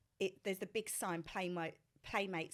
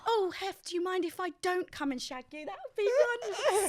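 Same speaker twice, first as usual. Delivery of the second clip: high, sing-song fake courtesy; overly polite, higher and louder; sweet mock politeness; 10 semitones higher, 9 dB louder than usual. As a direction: turn him down as if offering tea. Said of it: "I was honestly like".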